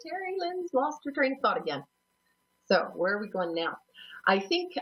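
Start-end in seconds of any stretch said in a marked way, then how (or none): 0.0-1.8 high, playful self-teasing cheer; mock cheer. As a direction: cheer your own slip in a high voice. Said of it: "and just say, "Yay".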